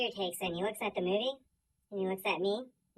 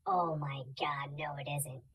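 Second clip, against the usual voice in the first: shocked whisper; 6 semitones lower, 10 dB quieter than usual. Retold or imitated. Viewer whispering in dawning horror at the screen. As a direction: breathe it out in disbelief. Realizing what the movie is.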